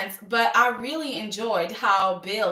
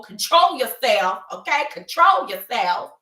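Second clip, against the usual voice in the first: loud, emphatic telling-off; stern scolding; 6 dB louder than usual. Retold or imitated. Host as Bill ordering his son, loud mock scolding. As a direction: come down hard on each "control".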